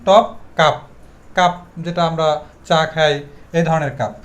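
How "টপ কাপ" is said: টপ কাপ with no puff on T or K; plain hard stops in টপ কাপ; old unaspirated way. Shown as a demonstration. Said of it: "previous time এটাকেও বলতাম".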